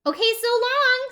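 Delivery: cheerful, very high sung-out farewell; Rosie. Acted. Tumbleweed saying goodbye to the cactus, cheerfully. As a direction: call it out high and carefree. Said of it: "said Rosie cheerfully".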